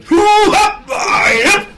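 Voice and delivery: high, loud mock drill-march yell; military shout. Eccentric woman marching down the road, loud drill yell. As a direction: bark it out like a marching soldier. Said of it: "like this".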